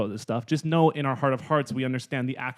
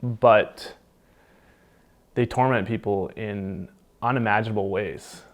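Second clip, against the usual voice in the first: ad read; 7 dB louder than usual. Acted. Reads ghost show ad.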